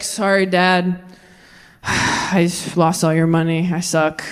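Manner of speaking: low, slow, sighing confession; sheepish apology. Acted. Returning son apologising to dad, low and sheepish. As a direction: mumble it low and defeated, after a sigh.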